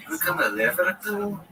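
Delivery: put-on high, bouncy Liverpool accent on a night-out invite; Scouse accent. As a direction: put on a Scouse twang, chatty and lively. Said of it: "Come on, the Scouse accent".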